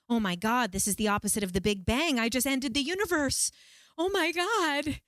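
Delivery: rising to a high, stretched cry; retold panic. Retelling to viewers how she ended the universe, rising panic. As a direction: build into acted-out horror.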